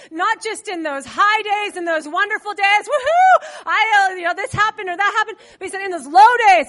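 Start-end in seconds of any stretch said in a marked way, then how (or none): 2.9-5.4 a bright whoop that breaks into a laugh; mock cheer. Preacher mimics a believer cheering on a good day, laughing mock whoop. Mocking the high-day praise. 5.8-6.7 preached emphasis. Acted.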